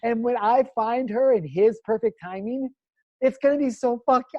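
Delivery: high, loud, drawn-out amazement; swelling, exultant wonder; acted awe. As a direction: marvel out loud, stretching the words. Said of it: "I was looking at it and observing it, going".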